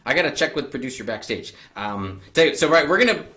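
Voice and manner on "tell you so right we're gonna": ordinary talk, nothing marked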